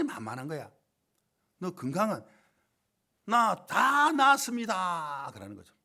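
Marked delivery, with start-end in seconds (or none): 3.2-5.3 drawn out very slowly, high and loud; joyful boast. Retold or imitated. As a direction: proudly announce he's fully healed. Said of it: "너무 기쁘게".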